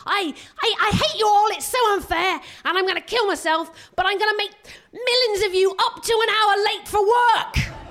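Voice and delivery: high, loud passive-aggressive whine; hammed-up sulky teenager, building to a laughing shout; mock teen wail ending in a laugh; petulant British teen. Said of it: "they go".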